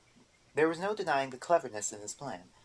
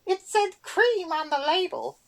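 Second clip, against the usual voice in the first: much higher, louder, slow squeal; screaming back; 10 dB louder, slower than usual. A narrator's reading. Erder screams back at the boggart, high loud squeal. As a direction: shriek back defensively. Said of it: "Erder screamed back at him".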